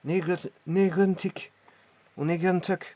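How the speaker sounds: "negentig" with a rasping g from the back of the palate; throaty g. Shown as a demonstration. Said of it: "du fond du palais".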